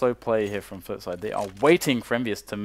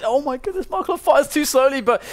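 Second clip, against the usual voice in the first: much higher, rising excitement in the call; excited; 14 semitones higher, 4 dB louder than usual. Real, not acted. Caster calling the clutch to his co-caster, much higher and excited. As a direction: caught up in the clutch moment.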